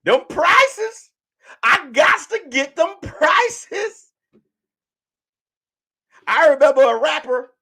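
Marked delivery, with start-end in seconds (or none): 0.0-1.1 "Them prices" pitched higher and stretched; mock craving for "Them prices"; drawn-out mock longing. 1.6-4.1 slow, higher mock craving; mock greedy bargain hunter; tempted shopper.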